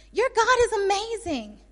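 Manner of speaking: high, loud, awed exclamation; amazed king. Acted. Acts out the astonished king.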